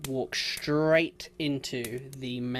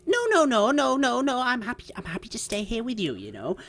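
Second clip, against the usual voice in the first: put-on high-pitched, fussing old dear; flustered old woman Margaret; 9 semitones higher, 3 dB louder, much faster than usual. Old lady assuring her companion she'll stay, flustered and high. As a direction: protest in a fluttery old lady's voice.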